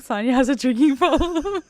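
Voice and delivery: high, loud, through laughter; laughing retelling. Retold or imitated. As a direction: crack up while quoting someone. Laughing while retelling a funny line.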